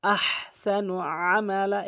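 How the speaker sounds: "আহসানু" with a strong throat h; correct recitation. Shown as a demonstration. Teacher models the right way, "আহসানু" with heavy h. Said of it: "সঠিকটি হচ্ছে".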